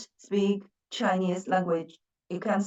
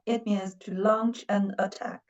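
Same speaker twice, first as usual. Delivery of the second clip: normal talking voice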